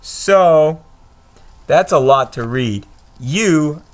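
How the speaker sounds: ordinary talk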